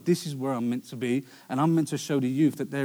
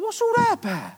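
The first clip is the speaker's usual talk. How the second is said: high, incredulous inner voice; mock disbelief at your mate; pitched-up what-on-earth question; baffled; 3 dB louder than usual. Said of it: "you're thinking".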